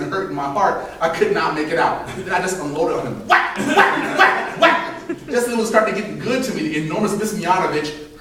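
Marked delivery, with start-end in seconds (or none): 3.3-5.0 high unvoiced whacks; whack sound effects. Kid beating Matthew with a yardstick, voicing the hits. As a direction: slap out each whack sharply. Imitating blows.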